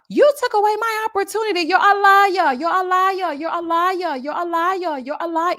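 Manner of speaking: hammed-up high whiny rant; mocking her shrill rant. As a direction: imitate her yelling liar over and over. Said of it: "got down to the mixer talking about".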